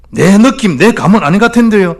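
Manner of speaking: high, loud mock of a doubter's excuse; hesitant believer. Preacher mocking a believer who won't share the gospel, high mock voice. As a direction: parody the timid excuse.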